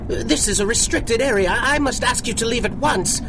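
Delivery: put-on a scared old man trying to sound firm; nervous alarm.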